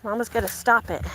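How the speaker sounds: ordinary talk, nothing marked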